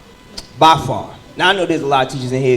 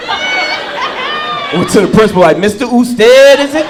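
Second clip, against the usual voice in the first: mock confrontation; 11 semitones higher, 5 dB louder, slower than usual. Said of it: "I was like".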